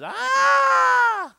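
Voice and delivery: huge high pained yell; man with numb legs yelling as he bolts. Retold or imitated. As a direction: let out a piercing howl of pain. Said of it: "he says".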